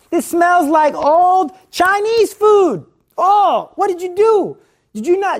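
high, loud, drawn-out disgust; husband disgusted by the smelly laundry, high and loud. Acted. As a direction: recoil at the smelly clothes.

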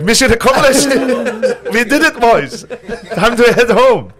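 a cartoon German officer celebrating, pitched high; triumphant mock German accent. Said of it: "You're good at German".

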